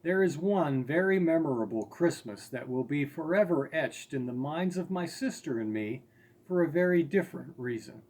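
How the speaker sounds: plain reading voice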